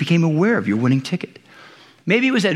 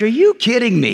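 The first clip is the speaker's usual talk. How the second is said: mildly exasperated mock scolding, raised pitch; 5 semitones higher than usual. Jesus to the two followers, mildly scolding. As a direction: act out an incredulous telling-off. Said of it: "this tone of like he's scolding".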